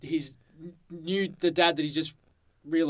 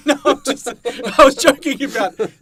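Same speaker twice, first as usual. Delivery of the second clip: much higher and louder, through laughter; laughing; 8 semitones higher, 11 dB louder than usual. Real, not acted. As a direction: laugh the words out. Laughing at own joke.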